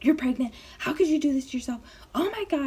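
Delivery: mock freak-out climbing in pitch; freaking-out mom. Mom reacting to daughter's supposed pregnancy, freaking out. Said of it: "she's, like, freaking out".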